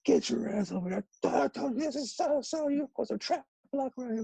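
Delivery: high-pitched mock coach yell; strained-up impression of a yelling coach; imitated coach yelling. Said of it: "like, when they're yelling".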